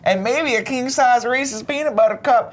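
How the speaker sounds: high, drawn-out Southern voice; slow, high-pitched comic Southern storyteller; southern accent. Acted. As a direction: stretch it out in a squeaky Southern accent. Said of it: "I don't know why I have a southern accent".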